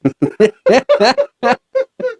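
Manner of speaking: high-pitched real laughter. Real, not acted. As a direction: laugh out loud, high and wordless. Laughs at host's explanation of song.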